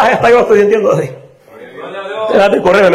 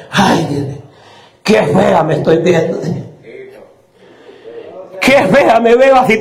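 mock vain old lady; 4 semitones lower than usual. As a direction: fuss over her looks in a mock voice. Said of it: "la viejita coqueta y dice". (burla ligera de una viejita presumida que se queja)

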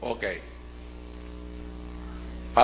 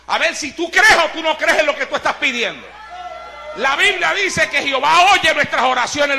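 raised, higher preacher's voice with loud bursts; forceful preaching; 11 semitones higher, 5 dB louder than usual. Real, not acted. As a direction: exhort the congregation, punching key phrases.

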